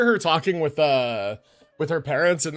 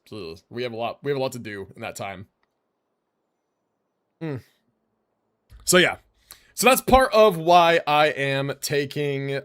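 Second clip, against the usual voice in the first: ordinary talk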